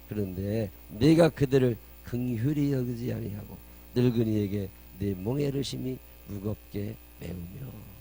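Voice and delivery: loud declaimed verse, slowing down; scripture proclamation. A narrator's reading. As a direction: proclaim it weightily, drawing out the last words. (큰 소리로 힘주어 끝을 늘이는 성경 봉독)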